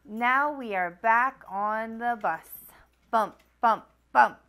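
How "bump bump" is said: ordinary delivery, nothing marked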